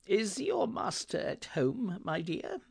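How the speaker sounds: high, slow, polite question; courteous Scrooge. Acted. Scrooge asking the servant girl, courteous. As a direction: ask gently in a high voice.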